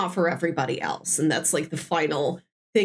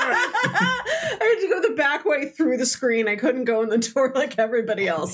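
playing Sweetums; 4 semitones higher than usual. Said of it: "I'm Sweetums".

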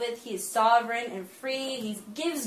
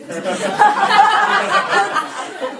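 long, loud burst of real laughter; 8 semitones higher, 10 dB louder than usual. Real, not acted. Preacher laughing at her reaction to the jail news, loud real laughter. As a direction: crack up out loud.

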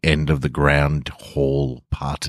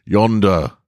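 slow, louder booming command; booming wizard; 5 semitones higher, 5 dB louder than usual. A narrator's reading. Grand Wizard demanding the stranger's name, booming. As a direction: boom it slowly from the throne. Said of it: "Grand Wizard".

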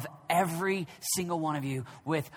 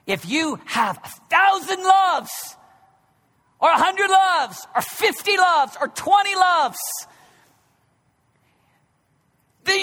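higher and loud, hammering each point; full-force preaching; 7 semitones higher, 5 dB louder than usual. Acted. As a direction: preach it out to the back row.

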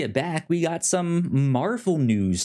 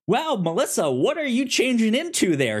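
mock chatty host; 11 semitones higher than usual.

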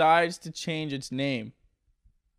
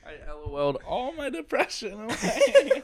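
higher, laughing through it; mock laughing; 7 semitones higher than usual. A mock patient brags of laughing depression away, laughing. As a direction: giggle it out like a cured patient.

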